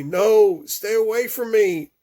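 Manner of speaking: high, loud panicked refusal; terrified child. Acted. Boy shrieking at the policewoman not to touch him.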